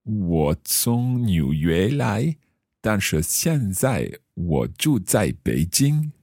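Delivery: slow, careful learner Mandarin; careful Chinese accent. Acted. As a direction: speak each syllable slowly, role-playing. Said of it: "my best Chinese accent".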